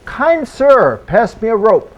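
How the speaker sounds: high, polite call from the mud; tall-tale character piping up; talking head's plea. Acted. Head in the mud speaks.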